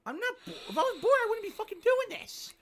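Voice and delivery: squeaky high whiny voice; high-pitched girl voice. Retold or imitated. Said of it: "High-pitched girl voice".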